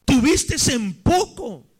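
high, insistent questioning in measured phrases; Dios. Retold or imitated. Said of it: "Dios se hace una pregunta y dice". (reproche divino, agudo e insistente)

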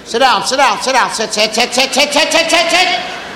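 rapid auctioneer chant of commands; tobacco auctioneer. Retold or imitated. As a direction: rattle it off like an auctioneer. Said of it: "Sound like a tobacco auctioneer".

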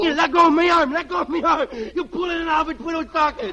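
put-on boy whining at his mother to let go; whiny kid protest. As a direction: whine as she yanks your arm. Kid's arm yanked by mother.

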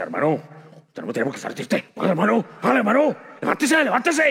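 un toque de hermano religioso que anima; religioso insistente. Retold or imitated. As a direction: empuja al triste con frases hechas. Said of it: "caen en la religiosa". (imitación ligera de un religioso insistente)

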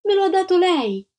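high, loud protest; indignant cry. A narrator's reading. Antonella to the lady, crying out in protest. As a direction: cry out against the lie.

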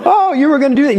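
high-pitched parent catching on; knowing parent. Acted. Parent to a crawling baby near an outlet, high knowing call-out.

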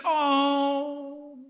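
mock-adoring aww; imitated cooing. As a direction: mimic a crowd melting over something cute. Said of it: "just like you said".

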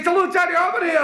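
very high, gabbling Glasgow caller; high-pitched Glaswegian phone panic; frantic Glasgow chap. Acted. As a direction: appeal to the operator in comic desperation. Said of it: "He says".

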